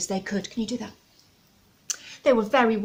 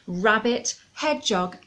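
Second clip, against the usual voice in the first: ordinary delivery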